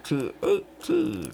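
slow, rasped Bane impression; a hint of Bane. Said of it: "Bane is like".

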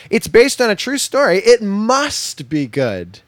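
high, loud, over-eager mimicry; mock excitement. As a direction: gush it like a gullible fan, drawing out the ending. Mimics people's gullible reaction to taglines.

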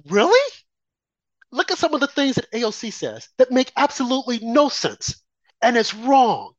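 indignant retort; disbelieving outcry. Retold or imitated. Own disbelieving reaction retold.

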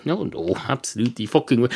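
ordinary delivery

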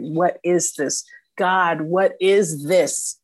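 drawn out, slowly pleading; slow anguished plea. Retold or imitated. Asking God on the drive home, a slow pleading cry. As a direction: stretch each word, asking God. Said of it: "I kept thinking".

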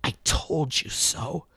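put-on a bitter old woman saying it low; an old woman scolding tautly; harsh old woman. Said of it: "taut, harsh voice of an old woman".

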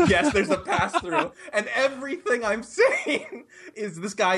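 high, squeaky laugh through the words; laughing confession. Real, not acted. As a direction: confirm it while cracking up. Laughs confirming the embarrassing blunder.

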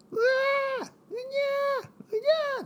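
put-on very high wailing baby cry; squealing mock newborn wail; baby cry imitation. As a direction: wail it like a newborn crying at night. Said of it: "そんな声じゃないな。".

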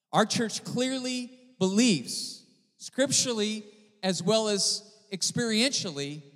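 high, loud preacher's proclamation, pressing each claim; full-force preaching. Acted. Pastor preaching to his church, loud and high. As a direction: preach it out over the congregation.

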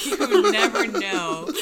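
laughing through the words. Real, not acted. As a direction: giggle out the line. Joking about fainting.

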